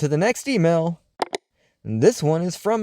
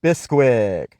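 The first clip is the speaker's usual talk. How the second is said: sponsor ad read; 5 semitones higher than usual. Acted. Host reading a Bisquick ad to his listeners, upbeat ad voice. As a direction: sell the pancakes like a radio huckster.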